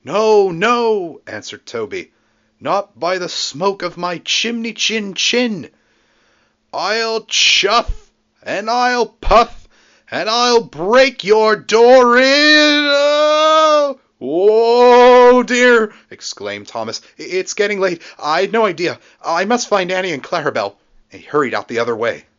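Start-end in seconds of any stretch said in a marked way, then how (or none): 0.0-1.2 high, loud panicky refusal; frightened cry. A narrator's reading. Toby answers fearfully, loud high. 2.6-15.9 high, loud, slow ghostly threat; booming ghost voice. A narrator's reading. 17.2-20.8 high, nervous excuses; flustered. A narrator's reading. Thomas flustered, higher.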